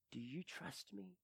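hushed, gentle whispered question; soft whisper. Retold or imitated. Yeshua speaks into the grieving father's heart, whispering. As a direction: ask it softly, close and tender. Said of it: "he spoke very softly into my heart".

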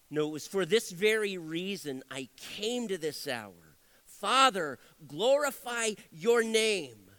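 slow, deliberate scripture reading; measured reading from the pulpit. Acted. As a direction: read the verse slowly, with weight. Reads scripture aloud.

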